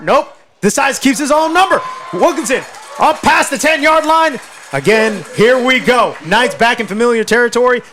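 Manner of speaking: excited football announcer; excited play-by-play. Real, not acted. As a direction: call the play as it breaks open.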